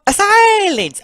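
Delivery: high, loud order; commanding Mario. Acted. Mario silencing his brother, commanding.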